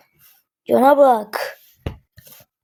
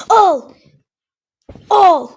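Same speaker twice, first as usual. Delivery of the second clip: louder, higher gloating shout; triumphant yell; 6 semitones higher, 5 dB louder than usual. Real, not acted. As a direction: yell it as you land the kill. Shouting while shooting enemy.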